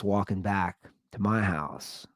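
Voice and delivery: usual speaking voice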